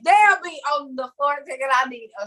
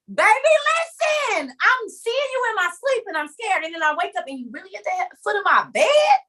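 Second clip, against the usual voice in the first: panicked pleading; 7 semitones higher than usual. Acted. Dreamer confronts the figure at the foot of the bed, panicked. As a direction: plead in a high, alarmed pitch.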